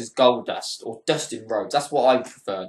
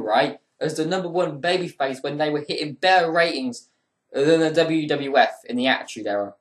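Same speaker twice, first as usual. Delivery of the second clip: ordinary talk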